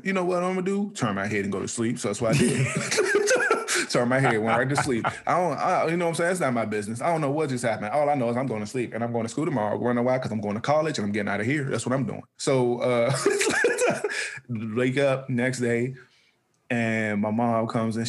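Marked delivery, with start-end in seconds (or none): 2.7-3.8 sudden high-pitched laugh breaking out; high burst of laughter. Real, not acted. Laughs at himself. 13.1-14.4 sudden high-pitched laugh breaking out; high burst of laughter. Real, not acted. Laughs at himself.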